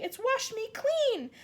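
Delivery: high, loud gleeful shout; the freed centipede shouts to the others; excited shout. A narrator's reading. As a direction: shout it out in delight. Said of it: "shouted the centipede excitedly".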